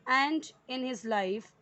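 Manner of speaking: very slow, word by word, each word laid out clearly; slow, careful classroom read-aloud; slow dictation reading. Shown as a demonstration. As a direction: read it out slowly for students to follow. Teacher reading sentence for class.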